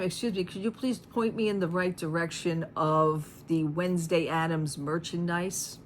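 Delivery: ordinary talk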